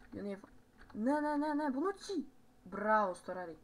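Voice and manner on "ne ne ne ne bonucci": urgent high, loud no-no-no; real alarm. Real, not acted. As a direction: cry out in panic at the defender. Alarm as play goes wrong.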